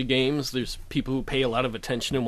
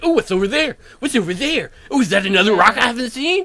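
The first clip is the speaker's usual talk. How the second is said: high, eager wondering; curious excitement; 9 semitones higher, 8 dB louder than usual. Retold or imitated. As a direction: gush like a kid exploring. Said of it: "I'm like".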